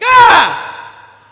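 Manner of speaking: high, loud squeal of joy; excited kid scream. Retold or imitated. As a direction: let out a thrilled child's shriek. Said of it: "I just started randomly screaming".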